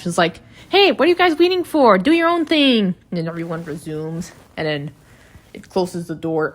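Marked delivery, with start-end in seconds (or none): 0.7-2.9 high, hurried mock-angry scolding; imitated pissed-off Gangle. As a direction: snap it out high, fast and loud, as if annoyed. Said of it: "Gangle gets pissed off".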